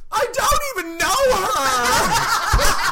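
high, slow, loud sobbing shout through laughter; mock crying wail. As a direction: wail it like a tearful little boy. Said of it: "he bursts into tears and he goes".

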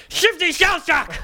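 high, slow, proud yell; all-caps shout. Acted. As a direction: bellow the name out like a boast. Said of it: "he wrote it all in caps".

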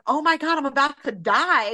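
a half-joking cry of doom; mock panic. Mother alone with kids during a scare, mock panic. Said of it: "I remember that going like".

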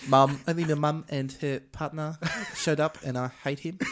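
put-on hammy Kiwi accent, laughing through it; badly faked New Zealand accent. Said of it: "That was an awful New Zealand accent".

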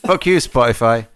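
high, loud mock curse; mock outburst. Friend cursing Spotify on his behalf, loud and high.

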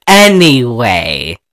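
slow, low "anyway"; drawn-out resumption. Real, not acted. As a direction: stretch out "anyway" to get back on track. Pointedly restarting after laughing at interruption.

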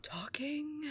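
breathless whispered echo. Retold or imitated. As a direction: whisper it in disbelief. Dog echoes in disbelief.

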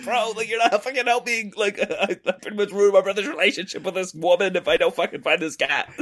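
high-pitched, half-laughing complaint; mock exasperation. Cat searcher to unhelpful stranger, comically exasperated. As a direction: plead in comic exasperation with someone no help.

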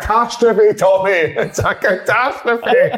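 high-pitched, drawn-out comic lament; mock outcry. Telling Tommy it's a catastrophe, hammed-up dismay. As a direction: wail it in mock dismay, stretching the second one. Acted quote in retold row, others laugh.

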